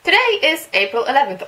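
bright, loud radio voice; reading a game's traffic report on air; radio host read. Acted. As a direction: read it like a morning DJ. Reads radio traffic update.

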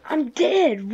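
dismayed outburst. Real, not acted. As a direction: yelp it out as you get killed. Killed in game, reacts.

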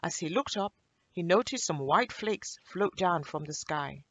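usual speaking voice

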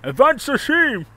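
high, shouted fake German accent; mock German accent. Streamer obeying a viewer's request, mock German accent. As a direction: bark it in a hammed-up German accent. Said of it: "Pronounce it in German accent".

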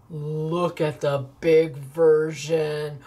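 low and very slow, drawing out big; big deep voice. Pretend. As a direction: make big sound huge, low and slow. Showing off the big version.

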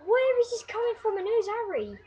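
usual speaking voice

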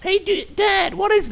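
put-on high whiny kid begging. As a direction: beg like a little kid.

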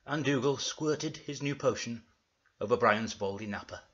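ordinary talk, nothing marked

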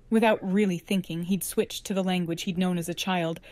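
ordinary delivery, nothing marked

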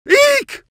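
very high squeaky eek; high-pitched fright squeak; startled squeal. Acted. Character startled.

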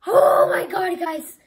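loud overwhelmed cry; near-tearful excited shout. Real, not acted. Overwhelmed reaction to pull.

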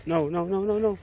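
urgent scolding repeat. Real, not acted. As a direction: stop the dog fast. Scolding the dog again.

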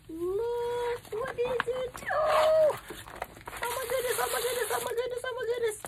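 high-pitched, breathless-with-delight squeal; real, overwhelmed high-pitched excitement; giddy excitement. Real, not acted. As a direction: squeal as the surprise appears. Overwhelmed on discovering the gift.

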